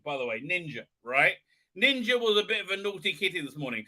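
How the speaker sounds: ordinary talk, nothing marked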